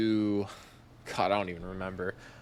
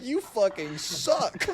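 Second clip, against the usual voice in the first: higher, slow teasing jab; mock roast; 7 semitones higher, slower than usual. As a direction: roast your buddy playfully. Friends roasting the dunker.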